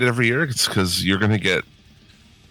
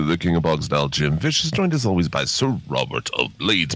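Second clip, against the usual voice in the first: ordinary talk, nothing marked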